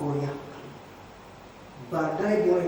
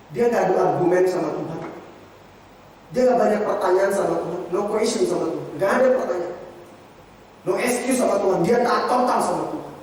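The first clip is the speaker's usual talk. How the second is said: louder, emphatic sermon delivery; forceful preaching; 4 semitones higher, 9 dB louder than usual. Acted. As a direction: press each point home to the congregation.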